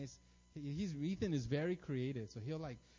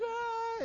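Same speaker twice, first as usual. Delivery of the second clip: high, loud mock wail; imitated child crying; 6 dB louder than usual. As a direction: wail like an upset little boy. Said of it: "Ethan just cries".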